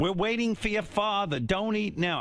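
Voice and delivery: high-pitched mom scolding; mom impression. Mom telling her child to wait for father, high-pitched scolding. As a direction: imitate Mom laying down the dinner rule. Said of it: "My mom would go".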